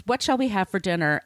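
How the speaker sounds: ordinary talk, nothing marked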